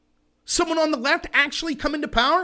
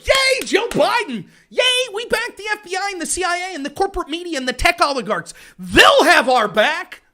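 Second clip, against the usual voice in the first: mock cheering supporters; 5 semitones higher, 4 dB louder than usual. Biden supporters cheering the agencies, hammed-up mock cheer. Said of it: "that are like".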